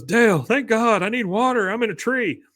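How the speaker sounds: high, pleading character voice; Bill. Retold or imitated. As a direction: imitate a stranded man begging for help by radio. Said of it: "radio that they gave Bill".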